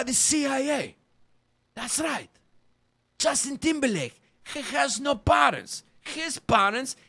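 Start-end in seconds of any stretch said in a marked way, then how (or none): none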